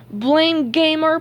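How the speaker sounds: put-on irritated childish comeback; snapped retort. A narrator's reading. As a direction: throw the name back crossly. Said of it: "Patrick snapped".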